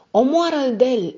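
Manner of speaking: loud, high frantic scream. Acted. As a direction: shriek at him to kill the man. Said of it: "a strigat blonda".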